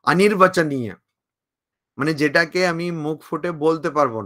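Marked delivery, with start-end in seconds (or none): none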